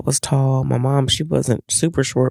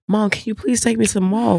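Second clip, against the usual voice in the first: put-on higher, pleading teen voice; imitating daughter; 8 semitones higher than usual. Daughter begging mom for the mall, pleading. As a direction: beg like a whiny kid.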